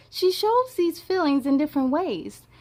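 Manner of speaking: ordinary delivery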